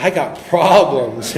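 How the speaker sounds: emphatic slow restatement. Shown as a demonstration. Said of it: "I'm, I got problems".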